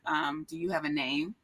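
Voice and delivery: a hint of a stern guard, low and quiet; lightly voiced, deadpan security guard; very serious. Imitating the security guard, lightly and very seriously. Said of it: "Very serious".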